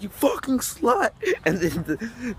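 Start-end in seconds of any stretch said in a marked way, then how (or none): 0.0-1.1 drawn-out, high mock yell; imitated yelling. Vlogger retelling a lady's insult, high mock yelling.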